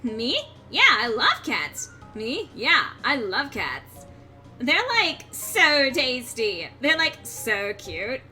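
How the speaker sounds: put-on drawn-out bubbly valley girl; slow and dreamy, like a mall girl; Valley Girl accent. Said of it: "Valley Girl accent".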